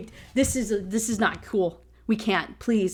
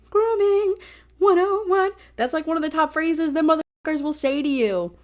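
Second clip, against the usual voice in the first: outraged call-out; 8 semitones higher, 6 dB louder than usual. Real, not acted. Outraged at the grooming line.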